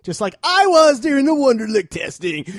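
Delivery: loud, high boasting voice; boastful put-on voice. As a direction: brag it in a silly voice.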